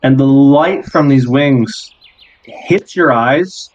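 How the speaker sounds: slow, measured scene-setting with long pauses; game master narration. Acted. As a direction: unveil the vision piece by piece, unhurried.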